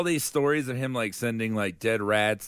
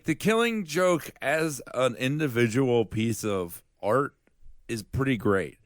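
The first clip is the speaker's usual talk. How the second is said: usual speaking voice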